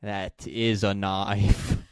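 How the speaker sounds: slow, drawn-out Aussie knife man; mock Australian accent. Answering co-host about a knife, slow mock Australian accent. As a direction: savor each word in a cartoon Australian accent.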